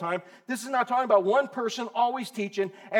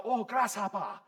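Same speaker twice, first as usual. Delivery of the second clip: Kung Fu master; 6 dB quieter than usual. Retold or imitated. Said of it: "he'd have grasshopper".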